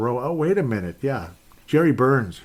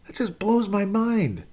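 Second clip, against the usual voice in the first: slow, much higher voiced disbelief; incredulous high repeat; 10 semitones higher, slower than usual. Real, not acted. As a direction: say it again, pitched way up in amazement.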